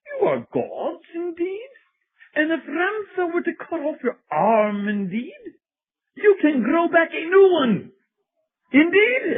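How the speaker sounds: high-pitched mock British guru; hammy British channeled-spirit voice; fake British accent. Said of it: "in a fake British type accent".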